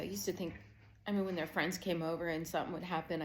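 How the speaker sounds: ordinary talk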